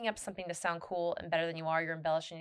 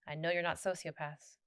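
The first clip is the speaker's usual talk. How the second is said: ordinary delivery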